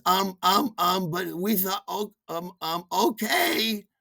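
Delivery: high, hesitant sister voice; sister flustered. Retold or imitated. 1 sister stammers over the intercom, high and hesitant. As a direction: stammer like a caught-out little sister. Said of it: "She says".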